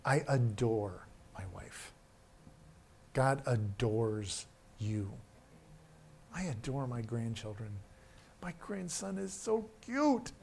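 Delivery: slow, hushed, lingering on adore; tender hush over the congregation; tender, slow and soft. Real, not acted. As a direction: let each adore line fall soft and slow. Slow soft repeated adore lines.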